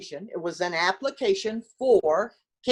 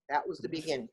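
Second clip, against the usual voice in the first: softer, lower repeat; quiet echo; 6 semitones lower, 8 dB quieter, faster than usual. Real, not acted. Telling Chris how her career started, soft and reflective. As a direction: let it fall away softly.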